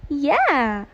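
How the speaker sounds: loud, high, thrilled hello; excited. Acted. Teen girl thrilled her crush is calling. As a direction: bursts out on the phone.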